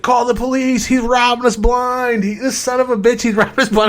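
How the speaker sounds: high, loud mimicked outrage; screaming accusation. Son telling mom to call the police, screaming. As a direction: holler for help way up high, imitating the son. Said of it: "He starts screaming".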